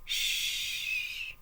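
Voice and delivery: soft unvoiced shh; a man hushing his crying wife. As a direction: hush her gently. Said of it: "he whispers".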